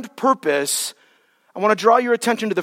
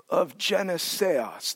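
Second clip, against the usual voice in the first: slow, soft, lower "of Geneseos"; slow Greek reading; 4 semitones lower, 9 dB quieter, slower than usual. Shown as a demonstration. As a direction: quietly draw out "Geneseos". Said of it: "read it again slowly in Greek".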